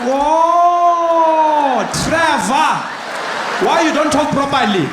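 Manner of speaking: put-on loud, high, drawn-out cry of realisation in African accent; booming mock-exasperated guard in African accent; security guard suddenly understanding. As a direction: shout it as a huge dawning revelation. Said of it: "He was like".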